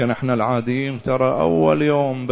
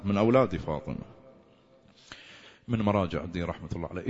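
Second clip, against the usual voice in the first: hushed storytelling; 4 dB quieter than usual. Acted. Preacher telling the scholar's dream to the mourners, soft and hushed. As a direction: draw them in close with a hush.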